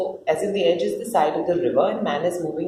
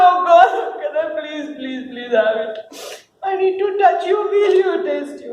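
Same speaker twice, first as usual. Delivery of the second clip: desperate pleading; 7 semitones higher than usual. Acted.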